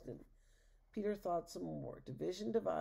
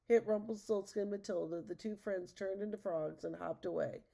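ordinary delivery

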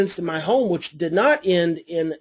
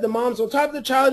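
normal talking voice

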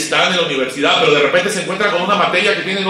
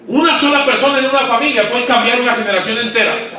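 full-force preaching; 5 semitones higher than usual. Acted. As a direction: preach it out to the pastors.

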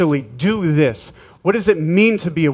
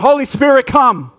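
louder call; 7 semitones higher, 4 dB louder than usual. Shown as a demonstration. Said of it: "More, come on".

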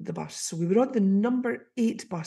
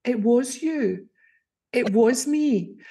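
slow emphatic reveal; 4 semitones higher, 4 dB louder, slower than usual. Retold or imitated. Messaging Deborah, slow emphasis.

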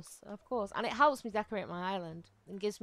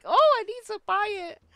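much higher, louder hammed-up longing; mock desperate craving; 13 semitones higher, 15 dB louder than usual. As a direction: play up her own shopping obsession. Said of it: "I'm like".

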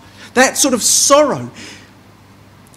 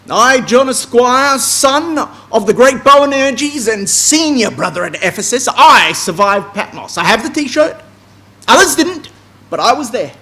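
boastful John; 6 dB louder than usual. Retold or imitated. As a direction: puff yourself up like a bragging apostle.

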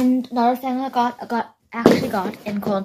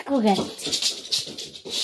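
whispering; 6 dB quieter, much slower than usual. Real, not acted. Whispered to dog.

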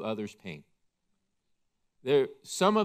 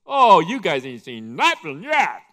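gleeful boast; 3 semitones higher, 12 dB louder than usual. Pretend. Preacher imagining himself teasing the mourners, loud gleeful boast. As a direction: gloat like you know the ending. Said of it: "I'd be laughing".

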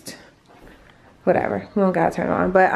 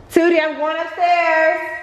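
higher, calling across the house; 8 semitones higher than usual. Real, not acted. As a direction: call out to someone downstairs. Calling out to someone.

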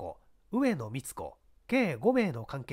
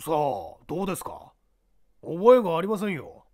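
lightly voiced evasive young man; evasive suspect; 3 semitones higher, 9 dB louder than usual. Suspect brushes off the inspector, a touch defensive. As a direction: shrug off the question, louder at the end.

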